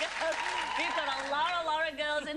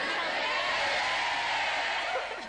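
loud laugh; 4 semitones higher, 5 dB louder than usual. Real, not acted. Host laughs about the joke.

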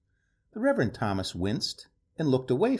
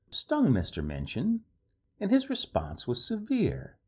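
ordinary delivery

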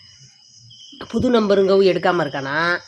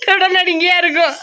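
high, loud words breaking into laughs; teasing laughter; 9 semitones higher, 4 dB louder than usual. Real, not acted. Partner teasing that the child takes after the other parent, laughing. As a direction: tease them while cracking up.

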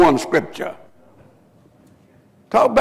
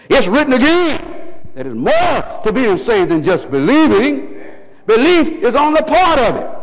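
high, emphatic preacher's cry; full-force preaching; 8 semitones higher than usual. Real, not acted. Preacher warning the congregation about the devil's tricks, impassioned.

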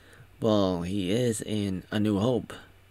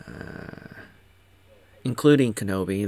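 ordinary talk, nothing marked

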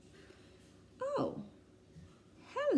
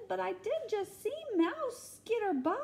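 higher elephant voice; 12 semitones higher than usual. Acted. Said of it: "says Elephant".

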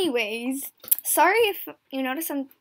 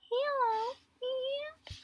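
put-on old lassie voice; 12 semitones higher, 4 dB quieter, much slower than usual. Character voice continues.

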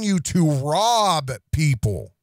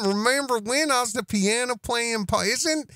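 a lightly voiced imitation of the newcomer; mock impression; 7 semitones higher than usual. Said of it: "his brand new character".

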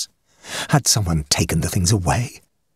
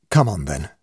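ashamed man giving in to the doctor; sullen; 6 semitones lower than usual. Acted. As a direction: give in reluctantly. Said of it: "I said sullenly".

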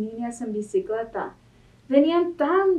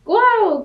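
a loud, much higher "Guau"; dog bark; 10 semitones higher, 8 dB louder than usual. Acted. Dog answering Duck with a loud high bark. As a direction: yap it like a little dog. Animal noises in picture book.

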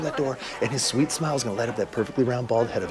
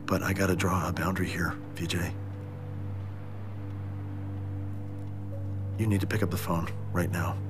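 lower, softer, subdued plea; quiet apology; 8 semitones lower, 8 dB quieter than usual. Acted. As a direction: pull it all back down, pleading. Calmer apologetic voicemail after outburst.

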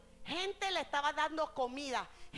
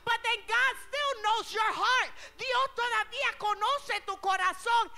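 loud, high preaching in Spanish and English; raised voice, driving the point home; full-force preaching; 10 semitones higher, 9 dB louder than usual. Acted. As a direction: preach it out to the whole church. Preacher addressing congregation.

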